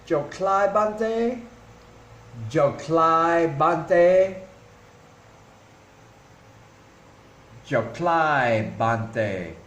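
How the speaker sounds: "Joklai bante" drawn out very slowly, syllable by syllable; slow Khmer. Shown as a demonstration. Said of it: "you would say".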